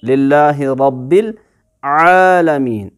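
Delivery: अल्हम्दु लिल्लाहि रब्बिल आलमीन in recitation voice, आलमीन drawn out; Quran recitation. Shown as a demonstration. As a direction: recite it, holding आलमीन long. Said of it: "तो पढ़ेंगे".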